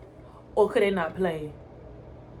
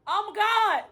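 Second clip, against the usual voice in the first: ordinary talk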